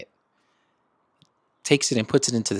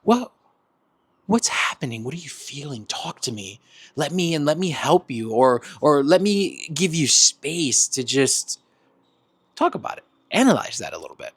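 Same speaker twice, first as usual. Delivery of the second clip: gentle check-in; 4 semitones higher than usual. Retold or imitated. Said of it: "you're like".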